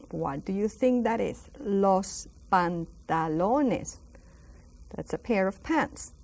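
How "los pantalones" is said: Los pantalones, very slow and drawn out; slow model word. Shown as a demonstration. Teacher slowly modelling a long word: los pantalones. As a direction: spell it out slowly for learners. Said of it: "That's a very long one".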